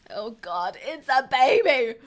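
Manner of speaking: loud shocked cry. Real, not acted. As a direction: blurt it out in shock. Shocked by the baby.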